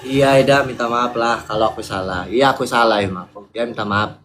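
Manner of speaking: low, resigned apology; forced apology. Real, not acted. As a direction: give in and apologise without conviction, voice dropped. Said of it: "Minta maaf terpaksa kayak gitu". (minta maaf terpaksa dengan nada rendah)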